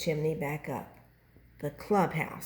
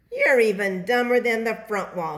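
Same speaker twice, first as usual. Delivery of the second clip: louder angry yell; mild scream; 5 semitones higher, 9 dB louder than usual. A narrator's reading. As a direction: yell at the wall that hit him. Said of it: "he screamed".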